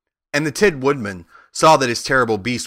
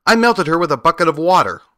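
lightly voiced girl, higher and louder; girl's answer; 6 semitones higher, 6 dB louder, faster than usual. A narrator's reading. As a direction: answer up high and emphatic, as a girl. Girl proudly answers the Wizard.

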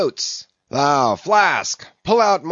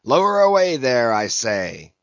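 thundering order; 5 dB louder than usual. A narrator's reading. Captain orders his amazed men to the boats, thundering. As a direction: thunder it out insistently. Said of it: "Such was the thunder of his voice".

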